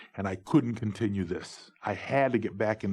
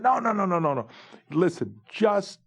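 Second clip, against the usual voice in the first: much higher, louder rapid no's that settle; hasty protest; 7 semitones higher, 4 dB louder than usual. Retold or imitated. Ron hurriedly calming Spike about the money, much higher and louder. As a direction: wave off the objection in a rush.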